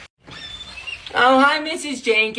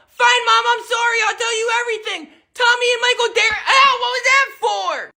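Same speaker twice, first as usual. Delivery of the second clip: whiny shout; 10 semitones higher than usual. Acted. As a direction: wail it out to Mom. Confessing loudly, then hit.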